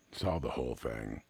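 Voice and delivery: plain reading voice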